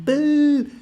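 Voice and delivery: put-on very high, loud childish boo; booing little baby. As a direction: squeal a boo like a toddler. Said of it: "get your little babies out going".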